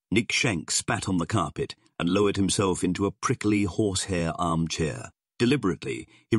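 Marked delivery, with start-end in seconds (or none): none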